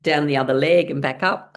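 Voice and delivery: usual speaking voice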